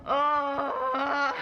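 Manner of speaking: loud exasperated ugh; fed-up groan at a dull book; frustrated groan. Real, not acted. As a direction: groan out the boredom. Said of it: "I'm done. I can't do it anymore".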